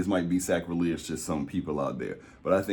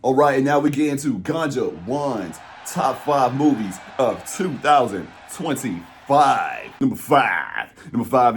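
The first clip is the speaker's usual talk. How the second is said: slow, loud, drawn-out announcement; stretched-out showman's countdown call; countdown announcer; 6 semitones higher, 6 dB louder, slower than usual. Real, not acted. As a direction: announce the big list with relish.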